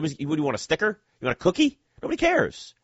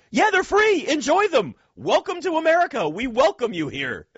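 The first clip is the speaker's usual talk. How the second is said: excited; 12 semitones higher than usual. Real, not acted. Welcoming Japanese tourists to free refills, excited and much higher. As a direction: cheer the visitors on with glee.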